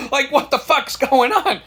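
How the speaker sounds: high, loud, laughing mock alarm; laughing disbelief. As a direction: blurt it through laughter, mock-panicked. Laughing at his own alarm.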